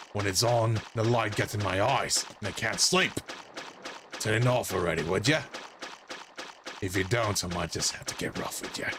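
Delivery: threatening dolphin. Acted. Voices dolphin's translated threats.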